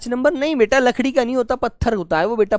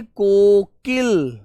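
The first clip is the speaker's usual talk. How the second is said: Kokil stretched slowly, syllable by syllable; slow drawn-out word; much slower than usual. Shown as a demonstration. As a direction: model Kokil slowly for the class to repeat. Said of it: "क्या बोलते हो प्यारे बच्चे?". (कोकिल को धीरे-धीरे खींचकर बोलना)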